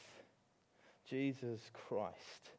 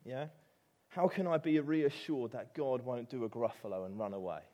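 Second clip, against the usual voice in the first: ordinary talk, nothing marked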